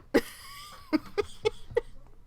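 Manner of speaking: a long, high-pitched giggle; bursting into a high laugh at the possum's name. Real, not acted. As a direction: giggle at your own joke. Laughing after naming the possum.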